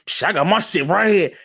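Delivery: ordinary delivery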